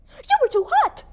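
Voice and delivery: very high-pitched cry, no extra volume; acted scream. Nurse screams at the husband, hysterical. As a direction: throw the voice high in hysterical accusation. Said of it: "she screams at my husband".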